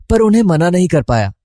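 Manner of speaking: plain reading voice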